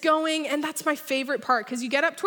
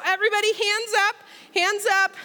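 excited call; 7 semitones higher, 3 dB louder, faster than usual. Real, not acted. Pastor rallying congregation on ride.

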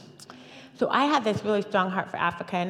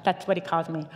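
ordinary talk, nothing marked

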